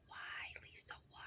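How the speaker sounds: imitating her husband in a whisper. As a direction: hush it like the exasperated husband. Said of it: "he's like".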